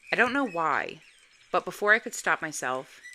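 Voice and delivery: plain reading voice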